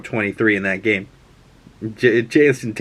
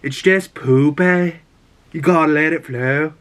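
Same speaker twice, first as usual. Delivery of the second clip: Jamie Tart impression in a raised Liverpool accent; playful mock Liverpool accent; 5 semitones higher than usual. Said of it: "in his little Liverpool accent".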